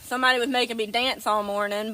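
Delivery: ordinary talk, nothing marked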